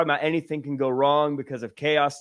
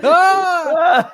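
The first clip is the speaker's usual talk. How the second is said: laughing shriek; 14 semitones higher, 10 dB louder than usual. Real, not acted. Laughing at the toilet audition.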